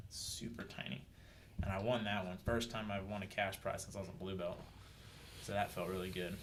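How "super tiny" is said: breathy whisper, no voice; whispered aside. Real, not acted. Telling friends about the tiny opponent, whispered. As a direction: drop to a whisper for the punchline.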